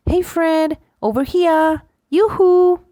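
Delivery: high, loud call across the road. Shown as a demonstration. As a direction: call to a friend over the road. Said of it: "something noticeable".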